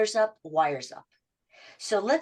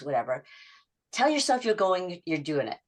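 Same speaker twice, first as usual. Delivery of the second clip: ordinary talk, nothing marked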